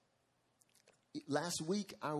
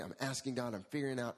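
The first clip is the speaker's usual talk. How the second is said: usual speaking voice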